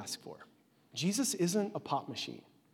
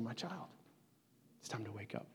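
soft, hushed, lightly voiced Jesus; softly, lightly voiced tender call; a hint of Jesus' tender voice; 5 semitones lower, 15 dB quieter than usual. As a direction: gently wake a sleeping child. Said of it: "and saying".

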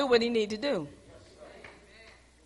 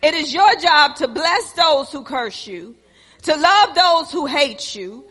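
rising sermon; 4 semitones higher, 5 dB louder than usual. Acted. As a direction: exhort the church, building as you go. Preaching to congregation.

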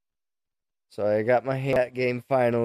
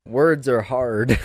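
normal talking voice

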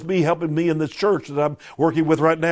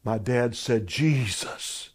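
soft, low, reverent; a hushed, reverent retelling; 4 semitones lower, 6 dB quieter, slower than usual. Retold or imitated. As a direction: lower the voice for the dying father's word. Dying father's last word, hushed.

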